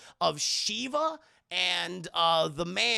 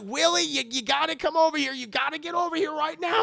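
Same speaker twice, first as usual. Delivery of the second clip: higher, louder insistent orders; urgent demand; 10 semitones higher, 6 dB louder than usual. Acted. Indy loudly ordering Willie to get over here. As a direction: push her to hurry.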